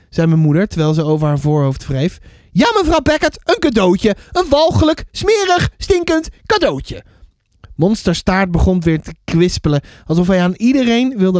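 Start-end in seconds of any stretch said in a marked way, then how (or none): 2.5-7.0 disgusted neighbour, very high voice; mevrouw Banks. Acted. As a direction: spit out each adjective with high-pitched disgust. Disgusted neighbour spelling out her complaint. (hoge stem vol walging)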